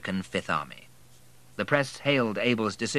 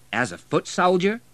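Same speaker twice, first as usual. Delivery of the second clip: high disbelieving question; can't quite believe it; incredulous; 10 semitones higher, 5 dB louder than usual. A narrator's reading. General doubting a millionaire wants to be infantry, incredulous. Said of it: "inquired the incredulous general".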